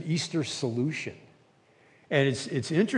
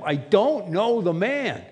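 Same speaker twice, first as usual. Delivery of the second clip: high, louder and slow denial; swearing denial; 6 semitones higher, 4 dB louder, slower than usual. Retold or imitated. Peter swearing to the crowd, loud slow denial. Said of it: "began to call down curses".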